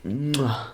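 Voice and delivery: kiss your fingertips over the pork; chef's kiss. Real, not acted. Praising the roasted pork sandwich.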